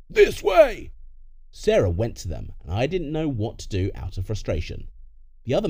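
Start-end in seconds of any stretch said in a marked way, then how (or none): 0.1-0.9 very high, loud command; shouted order. Acted. Masked intruder summoning a captive, shouted order. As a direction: yell it at a captive.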